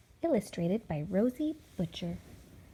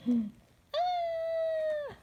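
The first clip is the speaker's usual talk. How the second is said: high squeal. Acted. Dramatic exclamation.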